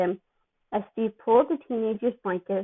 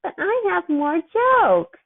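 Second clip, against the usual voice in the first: put-on drugged kid; 9 semitones higher, 4 dB louder, slower than usual. Drugged Peter pleading from ceiling.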